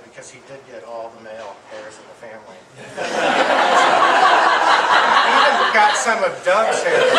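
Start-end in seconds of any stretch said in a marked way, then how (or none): 2.6-5.2 a loud breathy burst of laughter; big laugh. Real, not acted. Eulogist laughing at the family hair joke, big laugh. 5.2-7.1 laughing. Real, not acted. Joke about hair, still laughing.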